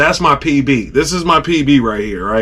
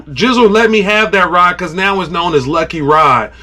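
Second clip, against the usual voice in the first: normal talking voice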